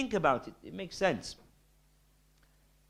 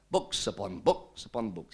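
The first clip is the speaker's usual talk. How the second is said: lower-pitched imitation of a Lancashire accent; 5 semitones lower, 4 dB quieter than usual. Summing up the man's answer, imitated Lancashire accent. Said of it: "in his broad Lancashire accent".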